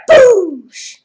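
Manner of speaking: loud mock crash noise; crash sound effect. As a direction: blurt a crash sound into the mic. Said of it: "I'm imitating the crash".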